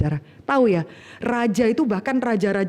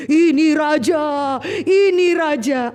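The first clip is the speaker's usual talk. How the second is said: crowd shouting; 3 semitones higher, slower than usual. Retold or imitated. The Jerusalem crowd hails Jesus as king, slow shout. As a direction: call it out like a cheering crowd.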